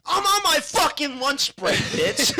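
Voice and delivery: acted furious shout, high and slow; Bad Santa. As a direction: yell it like a fed-up store Santa. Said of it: "and he's like".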